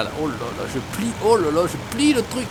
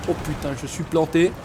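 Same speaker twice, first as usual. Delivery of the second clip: usual speaking voice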